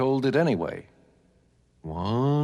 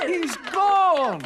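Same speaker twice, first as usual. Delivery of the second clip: high, exclaimed announcement; jubilant cry; 3 dB louder than usual. Acted. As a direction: proclaim the good news high and long. Townspeople rejoice at the disappearance.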